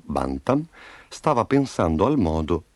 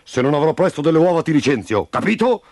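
fast, higher-pitched angry warning; shouted threat; 6 semitones higher, 4 dB louder than usual. A narrator's reading. Farm boss threatening rooster chief with sacking, shouting. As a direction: yell it quickly, demanding agreement. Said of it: "gridava al Castiga Galline".

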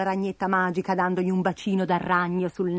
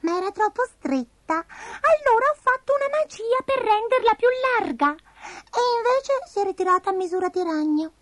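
very high cartoon creature explaining herself; tiny squeaky voice telling a mishap; falsetto, stretching out the excuses; Abigail the little spider, sheepish; 14 semitones higher than usual. A narrator's reading.

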